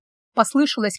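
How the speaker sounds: ordinary delivery, nothing marked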